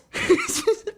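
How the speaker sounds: real high-pitched burst of laughter. Real, not acted. Reader amused by her own acted argument, high laugh. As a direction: crack up, high and brief.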